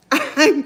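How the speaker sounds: short laugh at her own slip; embarrassed giggle. Real, not acted. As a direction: laugh it off sheepishly.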